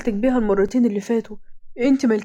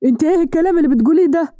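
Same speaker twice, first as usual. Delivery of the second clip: shocked; 4 semitones higher, faster than usual. A narrator's reading. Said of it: "بصت رزان بصدمة".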